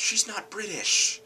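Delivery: high, slow, exasperated objection; incredulous. Real, not acted. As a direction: protest it slowly in high-pitched disbelief. Repeats disbelief at the casting.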